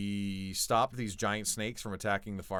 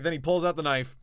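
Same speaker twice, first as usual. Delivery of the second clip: ordinary delivery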